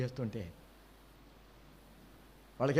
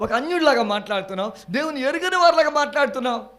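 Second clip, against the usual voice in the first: loud rebuke; 6 semitones higher, 7 dB louder than usual. Acted. Rebuking worldly talk.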